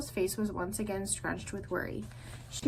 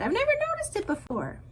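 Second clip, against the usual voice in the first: high gosling voice; 13 semitones higher, faster than usual. A narrator's reading. Gosling voice in story.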